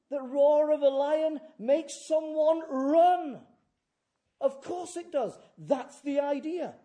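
high, loud, thundering sermon; full-force preaching. Real, not acted. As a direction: proclaim it with the force of a lion's roar.

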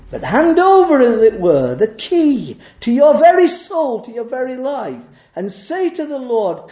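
high, loud pulpit climax; fiery preaching. Acted. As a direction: preach it out with full force. Preaching at full force.

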